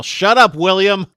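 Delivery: loud high shout; yelling. Retold or imitated. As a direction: yell it at him. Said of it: "Both Drew and Anya yell".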